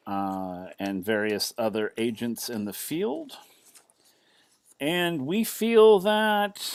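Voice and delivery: measured official address with long pauses; deliberate military commander. Acted. Commander formally praises the captain of the Windrider, deliberate and measured.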